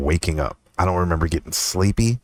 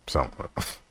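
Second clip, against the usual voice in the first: much softer Some of it, almost under breath; hushed repeat; 9 dB quieter than usual. Shown as a demonstration. Joking to viewers about data, hushed aside. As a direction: drop it to a hush. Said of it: "repeated quieter".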